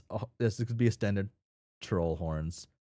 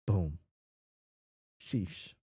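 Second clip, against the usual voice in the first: ordinary delivery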